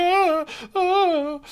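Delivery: high, thin frightened little whimper; mock scared whimper. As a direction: play the scared kid, lightly. Kid with bomb on camera.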